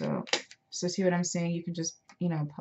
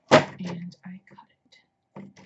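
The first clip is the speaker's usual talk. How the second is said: whispering; 7 dB louder, much slower than usual. Real, not acted. Admits impatient cut, whispered.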